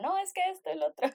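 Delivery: high-pitched mock protesting student; Jessica. Friend imitating her complaining in class, high mock protest. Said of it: "Y Jessica".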